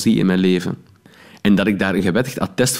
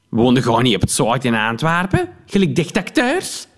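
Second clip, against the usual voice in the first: snooty woman; 4 semitones higher than usual. Acted. Snobbish woman questions the actor in the car park, sneering. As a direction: sneer it from up high.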